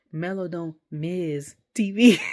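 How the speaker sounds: slow, spaced-out statement breaking into laughter; drawn-out punchline, laughing at the end; retold quip. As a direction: deliver the line slowly, tickled by it. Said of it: "And then he said".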